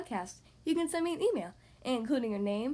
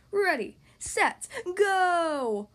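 loud, drawn-out starter's call; yelled race start; 5 semitones higher, 6 dB louder, much slower than usual. A narrator's reading. Judge starting the race, yelling slowly. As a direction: call the start, stretching each word. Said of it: "the judge yelled".

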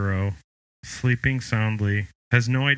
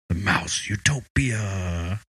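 dramatic whisper; 4 semitones lower, slower than usual. Acted. Spooky topic announcement.

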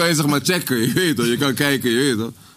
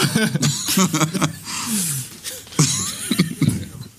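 higher-pitched, trailing real laughter; laughing fit; 4 semitones higher, 3 dB quieter than usual. Real, not acted. Friend laughs over the first rookworst, cracking up. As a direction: laugh on and let it trail away.

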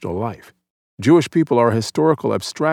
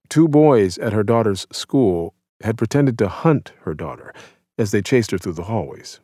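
ordinary delivery

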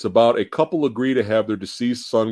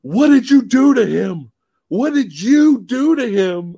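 high, loud accusing yell; mock the parents confronting the doctor; angry parents; 10 semitones higher, 5 dB louder than usual. Parents confront the scientist, loud angry yell. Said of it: "and they're all like".

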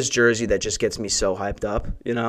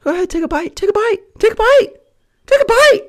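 squeaky cartoon mouse voice, much higher, sweetly holding back anger; sing-song falsetto with rage under the sugar; Mickey Mouse voice; 5 dB louder, faster than usual. To the customer, a high cartoon-mouse voice hiding anger. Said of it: "full Mickey Mouse voice trying to hold back the anger".

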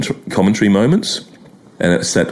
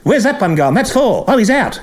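high excited commentator calls; fast, excitable radio calling; Norman May impression; 13 semitones higher than usual. Mimicking ABC radio cricket commentary, excited. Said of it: "Norman May".

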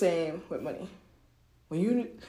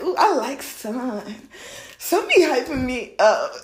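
higher, drawn-out purr of approval; flattered money; 4 semitones higher, 4 dB louder, slower than usual. Acted. Money enjoying having its ego fed, pleased drawl. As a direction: savour the flattery slowly, pitched up.

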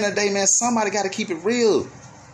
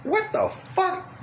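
disbelief; 12 semitones higher, much slower than usual. Real, not acted.

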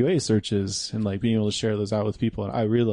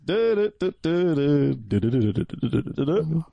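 mouthed fanfare, high start then quicker patter; hummed ceremonial intro in nonsense syllables; sung fanfare noise; 4 semitones higher than usual. Pretend. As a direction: do the honors with a "du-du-du" flourish. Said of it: "can someone do the honors".

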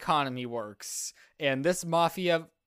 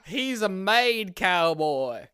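mobster voice; 5 semitones higher, 7 dB louder, much slower than usual. Pretend. Mafia cowboy bit.

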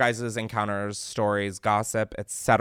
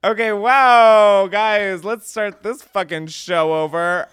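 louder, slowly drawn-out exclamation; overwhelmed, laughing it off; flustered outburst; 6 semitones higher, 8 dB louder, much slower than usual. Real, not acted. Flustered after embarrassing voicemail.

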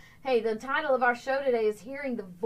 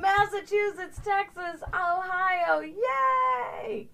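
high-pitched roll call ending in a shout; excited cheer; 8 semitones higher, 4 dB louder, much slower than usual. Real, not acted. Host greeting viewers from each state, excited cheer.

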